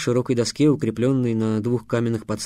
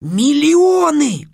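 much higher, louder, drawn-out slowly; boy swept up in his own speech; carried-away exclamation; 13 semitones higher, 7 dB louder, much slower than usual. A narrator's reading. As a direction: fling out the unthinkable word. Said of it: "сказал немыслимое слово".

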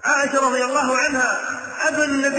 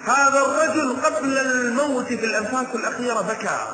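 ordinary delivery

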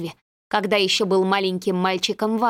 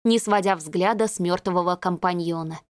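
ordinary delivery, nothing marked

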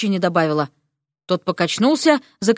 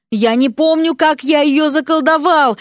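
higher, whiny groan; plaintive protest in a raised pitch; moaning; 4 semitones higher than usual. A narrator's reading. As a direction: moan it helplessly. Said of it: "простонал тот".

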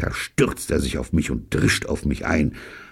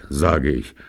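ordinary delivery

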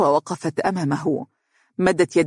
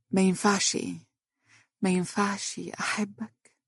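faint, much softer pleading; weakly; 3 semitones higher, 8 dB quieter than usual. A narrator's reading. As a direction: let the words fade out helplessly. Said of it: "وقالت بضعف". (بضعف وصوت خافت)

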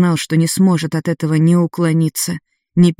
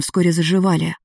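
ordinary delivery, nothing marked

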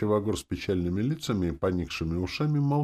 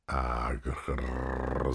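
low rolling growl; demonic growl; 4 semitones lower, 4 dB quieter than usual. A narrator's reading. Demoness angered by the bargain, growling. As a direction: growl long with a rolled r. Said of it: "зарычала демоница".

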